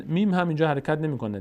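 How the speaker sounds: ordinary delivery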